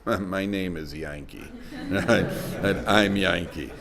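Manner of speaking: soft, slow, dry punchline; a low, dry little confession; quiet deadpan reveal. Retold or imitated. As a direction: hush it out slowly, straight-faced. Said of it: "He says".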